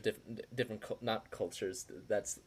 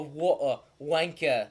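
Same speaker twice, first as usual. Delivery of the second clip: put-on lightly done posh British accent, slower; mock British accent; 2 semitones higher, slower than usual. Mocking how the English talk, light British accent. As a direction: sneer like a stuffy Englishman, a hint of British. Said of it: "England, they talk British".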